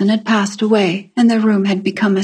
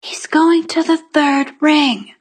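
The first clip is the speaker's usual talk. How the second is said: put-on high, carefully projected child's voice; self-conscious repeat in a higher voice; girl repeating herself; 6 semitones higher than usual. A narrator's reading. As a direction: pitch it up so he can hear. Girl repeats herself for unhearing man.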